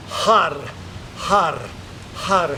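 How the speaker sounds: "Har" with a rolled Spanish R. Shown as a demonstration. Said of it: "hear me rolling my R's".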